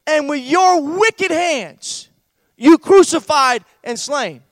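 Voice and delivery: loud, slow, drawn-out accusation; Peter's bold preaching. Acted. Peter accusing the crowd of crucifying Jesus, bold lifted-up voice. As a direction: thunder it out, leaning on wicked hands. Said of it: "Peter said".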